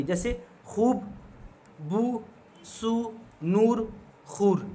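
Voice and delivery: खूब, बू, सू, नूर, खूर with stretched oo; slow, separate examples, खूब to खूर, each with long oo; drawn-out long oo. Shown as a demonstration. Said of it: "हम इसको खींच के पढ़ते हैं".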